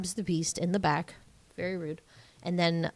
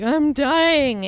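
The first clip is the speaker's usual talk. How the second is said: high, louder mock wail; dying beast; 7 semitones higher, 6 dB louder than usual. Beast to Belle, hammed-up high dying wail. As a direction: ham up a dramatic dying line. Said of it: "he's like".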